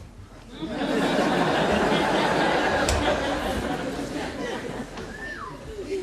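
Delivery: loud, drawn-out laughter; long laugh. Real, not acted. Audience laughing at the cell story. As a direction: let the laugh roll on.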